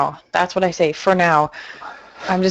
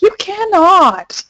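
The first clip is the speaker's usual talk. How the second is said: higher, drawn-out disbelieving retort; incredulous protest; 10 semitones higher, 4 dB louder, much slower than usual. Retold or imitated. Applicant protesting to the passport clerk about visible teeth, incredulous. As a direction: stretch it out in mock disbelief.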